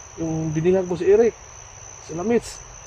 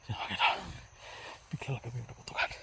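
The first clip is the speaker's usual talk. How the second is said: barely voiced hiding whisper; whispering while hiding; 4 semitones lower, 15 dB quieter than usual. Real, not acted. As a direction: whisper so the enemy can't hear. Hiding from gunfire.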